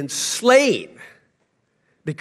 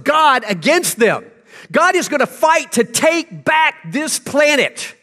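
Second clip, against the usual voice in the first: loud, higher preacher's shout; full-force sermon shout; fiery preaching; 7 semitones higher, 4 dB louder than usual. Acted. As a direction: thunder it from the pulpit. Preaching God's wrath.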